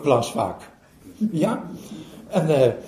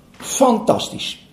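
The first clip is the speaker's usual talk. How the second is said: slow, hushed wonder; breathy amazed whisper; 5 semitones higher, much slower than usual. Acted. Zen master to the boys, hushed amazement. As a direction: whisper it in awe. Master admires the clean basket.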